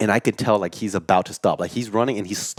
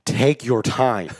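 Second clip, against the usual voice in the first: drawn-out, slow coaching; slow coaching tone to dad; slow, deliberate; 2 semitones higher, much slower than usual. Retold or imitated. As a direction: stretch each word out to calm him.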